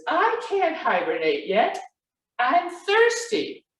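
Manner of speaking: high cub voice, rising to a stretched last word; protesting Baby Bear. A narrator's reading. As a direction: complain like a thirsty little cub.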